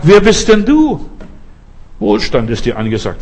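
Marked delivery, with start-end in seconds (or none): 0.0-1.1 high, loud, slow challenge; rising shout. Acted. Preacher shouting at the fearful believer, high and loud. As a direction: cry it out at the fearful listener.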